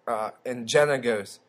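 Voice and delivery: normal talking voice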